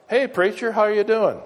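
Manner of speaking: high, loud call across the room; greeting hollered across a store; retold shout. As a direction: call out a cheery greeting from far off. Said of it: "he shouts".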